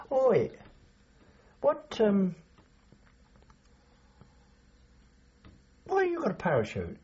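high, challenging call to a sergeant; imitated calling out. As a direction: call out high, acting the indignant soldier. Mate calls out to the sergeant.

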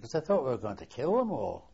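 usual speaking voice

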